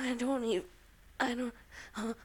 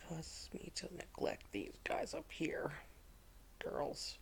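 whispering; 4 semitones lower, 10 dB quieter than usual. Real, not acted. Whispered trailing.

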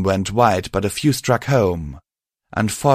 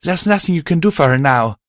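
urgent; 8 semitones higher, 4 dB louder than usual. Acted. Violet pleads with grieving Star.

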